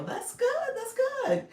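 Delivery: host thrilled by a viewer's news; delighted. Real, not acted. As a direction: squeal it with delight.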